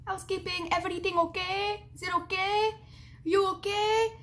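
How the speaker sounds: lightly imitated maid checking in; housekeeper calling. Housekeeper at the hotel door, high call.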